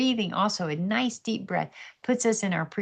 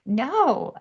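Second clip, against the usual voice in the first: put-on higher, louder childlike no; mock refusal; 8 semitones higher, 6 dB louder than usual. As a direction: blurt a stubborn no. Refusing God in retold dialogue.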